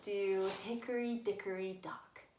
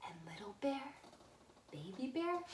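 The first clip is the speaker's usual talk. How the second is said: plain reading voice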